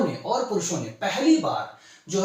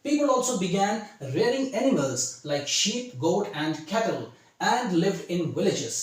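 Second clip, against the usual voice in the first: slow, measured classroom read-aloud; model reading; much slower than usual. Shown as a demonstration. Teacher shows students how to read this, slow model reading. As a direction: read it out slowly, phrase by phrase. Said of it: "अब how to read this".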